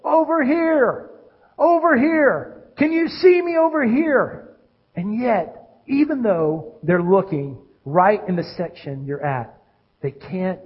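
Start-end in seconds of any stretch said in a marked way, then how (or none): 0.0-4.6 high, drawn-out call across a crowd. Acted. Fan waving to a friend in a crowd, calling out. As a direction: call out slowly to catch someone's eye. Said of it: "waving your arms, and you're saying".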